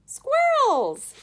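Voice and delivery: put-on spoiled girl screaming at the sight; shout. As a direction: shriek it with greedy glee. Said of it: "shouted Veruca Salt".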